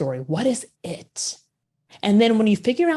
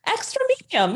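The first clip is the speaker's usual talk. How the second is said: amused echo. Real, not acted. Laughing echo of host's joke.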